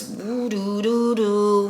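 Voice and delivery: drawn-out, woozy sing-song wooing; slow, swirling mock-dizzy sound; dizzy noise. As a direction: imitate your head spinning with silly syllables. Imitating dizziness with a sound.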